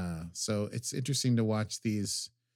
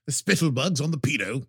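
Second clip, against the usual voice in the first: mock character voice; 3 semitones higher, 4 dB louder than usual. Savoring the quote in a voice.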